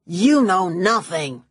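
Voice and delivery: lightly voiced Kleiner, slow and spat. A narrator's reading. Villain spitting at the narrator, slow and contemptuous. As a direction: spit it out with contempt. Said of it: "Kleiner spat".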